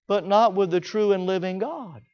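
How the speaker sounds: usual speaking voice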